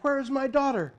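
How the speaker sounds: high loud angry demand. A narrator's reading. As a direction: insist on an answer now. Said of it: "he demanded".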